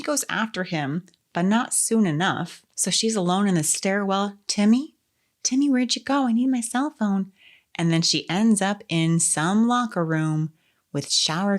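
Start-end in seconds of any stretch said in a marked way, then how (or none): 4.5-7.3 high, plaintive searching call; wounded fawn. Retold or imitated. Girl alone in the stairwell calling her friend, wounded fawn voice. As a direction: call out like a lost little fawn. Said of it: "Wounded Fawn impression".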